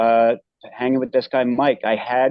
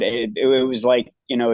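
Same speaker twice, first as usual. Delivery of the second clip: ordinary talk, nothing marked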